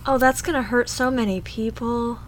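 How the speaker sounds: ordinary talk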